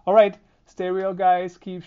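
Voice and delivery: loud, upbeat sign-off call; energetic goodbye shout. Real, not acted.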